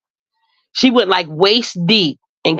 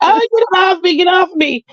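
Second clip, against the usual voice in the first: high, frantic cry to get it off; mock panicked scream; 12 semitones higher than usual. Retelling her mother at the beach, a high panicked mock scream. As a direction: squeal it like the stung mother in panic.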